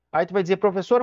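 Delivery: normal talking voice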